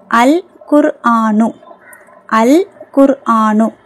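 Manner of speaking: slow "அல் குர்ஆனு" with a clearly sounded L; lam pronounced. Shown as a demonstration. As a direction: model "அல் குர்ஆனு" slowly, holding the L of அல். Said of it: "லாமை வந்து உச்சரிக்கணும்". ("அல் குர்ஆனு" லாம் தெளிவாக, மெதுவாக)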